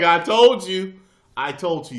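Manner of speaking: high, loud retort through laughter; loud self-quote, cracking up; laughing I-told-you. Retold or imitated. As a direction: crow it back, amused and loud. Retelling own reply, laughing.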